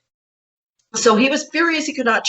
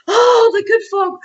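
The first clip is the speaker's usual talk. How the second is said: frantic shout; 11 semitones higher than usual. Retold or imitated. Wife frantically tells husband the fairies feel unwelcome, shouting. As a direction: wail in dread. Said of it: "I was like".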